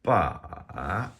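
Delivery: BA drawn out, down then back up; dipping tone. Shown as a demonstration. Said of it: "our dipping tone".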